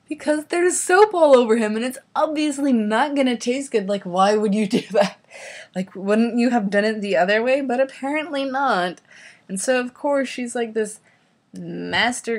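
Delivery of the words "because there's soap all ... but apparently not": louder, higher, fed-up rant; loud, exasperated disbelief. Real, not acted. Ranting at the book's scene.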